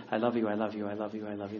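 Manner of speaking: low, soft, monotone repetition; one spouse. Acted. One spouse repeating endearments to the other, low monotone. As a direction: repeat it low and evenly, like a routine. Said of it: "the one's saying".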